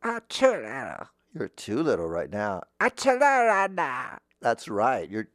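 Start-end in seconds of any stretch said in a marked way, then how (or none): 0.0-1.1 put-on raised, babyish toddler. Shown as a demonstration. Voice teacher trying out a kid character, toddler voice. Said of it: "That's a little toddler". 2.8-4.2 put-on high, loud little toddler. Shown as a demonstration. Voice teacher showing a too-little kid, toddler voice. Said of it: "like a toddler".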